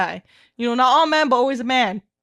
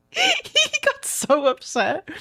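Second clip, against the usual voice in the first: laughing; 12 semitones higher than usual. Real, not acted. Laughing while retelling.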